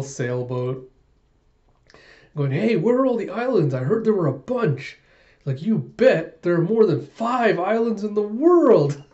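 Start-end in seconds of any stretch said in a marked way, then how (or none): 2.5-5.0 high, eager, acted-out chatter; hammed-up childlike eagerness; super excited. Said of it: "just getting super excited". 5.6-9.0 high, loud, drawn-out boast; hammed-up excited bragging; super excited. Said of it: "just getting super excited".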